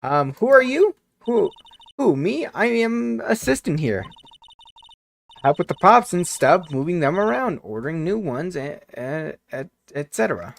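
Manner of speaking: high, nervous stagehand voice; nervous assistant voice. Acted. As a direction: voice a jittery young assistant. New character, the assistant.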